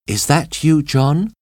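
high female voice answering from a distance; high, lightly acted call; woman calling back. A narrator's reading. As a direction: pitch it well up, a hopeful question. Hidden woman replies from afar.

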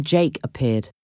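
ordinary delivery

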